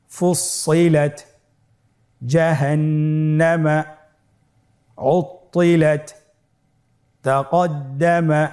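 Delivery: slow recitation with long held doublings; whole-word recitation. Shown as a demonstration. Teacher has learners read along, measured Quranic recitation. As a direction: recite each word connected, pausing for repeats.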